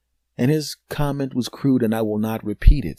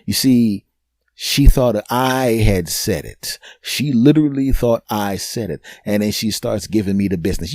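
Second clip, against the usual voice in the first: ordinary delivery, nothing marked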